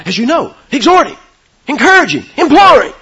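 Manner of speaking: preacher climbing to full voice; building urgent appeal. Retold or imitated. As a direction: build each word into a ringing plea. Quoting Paul's urgent appeal.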